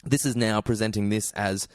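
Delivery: ordinary talk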